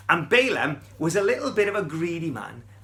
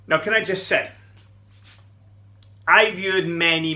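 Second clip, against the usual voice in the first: ordinary talk, nothing marked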